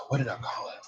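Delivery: hushed, searching whisper; whispered self-talk. Real, not acted. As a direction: whisper it to yourself while trying to remember. Asking herself while trying to remember.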